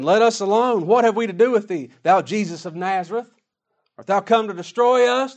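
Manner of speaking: loud, high, furious demon cry; demon yelling. Retold or imitated. Demon yelling at Jesus by the synagogue, loud and furious. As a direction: shout it like a demon in torment. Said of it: "he started yelling at the Lord".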